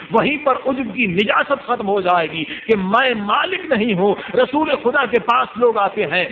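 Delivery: preaching at full force. Acted. Preacher rousing the gathering about pride, impassioned preaching. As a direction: preach it like the climax of a majlis.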